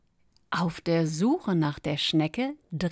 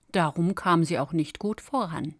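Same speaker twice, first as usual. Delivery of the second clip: usual speaking voice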